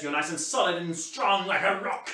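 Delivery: ordinary delivery, nothing marked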